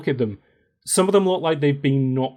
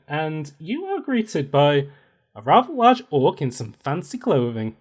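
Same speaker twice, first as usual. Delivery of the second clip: ordinary talk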